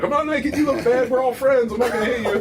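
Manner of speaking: high, loud mock reassurance; exaggerated high-pitched appeasing; comic placating. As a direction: plead peace in a put-on high voice, half laughing. Placating opponent, acted out comically.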